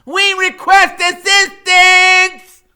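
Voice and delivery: high, loud, slow call to officers; high, drawn-out shout for help; shout to police. Acted. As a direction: hail the cops across the pond.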